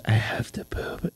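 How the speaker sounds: slow, hushed mock whisper; secretive acted whisper; retold whisper. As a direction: whisper it so dad stays asleep. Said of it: "whispering 'cause I didn't want".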